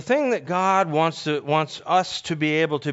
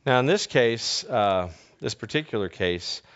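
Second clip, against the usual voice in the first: usual speaking voice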